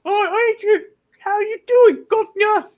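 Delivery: a squeaky mock Cockney, deliberately awful; bad Cockney accent. Shown as a demonstration. Showing friends how bad his Cockney accent is. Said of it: "Oh, no. That's so bad".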